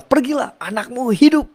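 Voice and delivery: high, ringing command; word of power. Retold or imitated. Jesus's powerful word to the official, high and ringing. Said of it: "perkataan kuasa".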